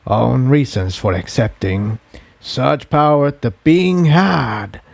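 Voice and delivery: low, slow, distant voice of an ancient being. Acted. The being tells Jigsaw how it consumed Spike, low and slow. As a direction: speak as something vast that has swallowed a soul.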